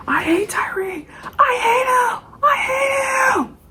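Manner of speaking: high, crying shout building up; spazzing out. Retold or imitated. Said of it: "this boy starts spazzing the fuck out".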